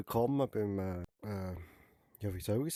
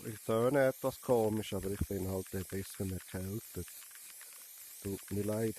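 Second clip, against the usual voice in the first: ordinary delivery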